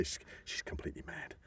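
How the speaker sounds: whispered gossip, completely unvoiced. Real, not acted. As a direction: lean in and whisper the secret. Confiding gossip about Helen Mirren.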